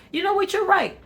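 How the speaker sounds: mock aha moment from a listener; imitating a viewer. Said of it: "you're like".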